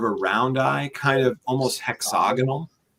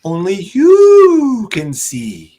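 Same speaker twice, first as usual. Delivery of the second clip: dramatic reveal; 8 semitones higher, 11 dB louder, much slower than usual. Acted.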